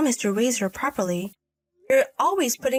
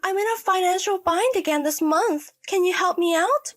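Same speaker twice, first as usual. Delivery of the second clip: higher-pitched daughter asking a favour; pleading adult daughter; 8 semitones higher than usual. Acted. Daughter asks her mother for money, pleading. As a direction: play the daughter, pitched up and needy.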